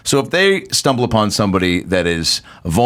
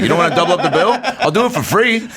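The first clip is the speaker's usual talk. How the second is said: laughing mock bartender; 8 semitones higher than usual.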